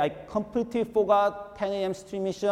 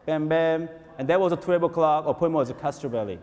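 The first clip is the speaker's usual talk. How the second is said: normal talking voice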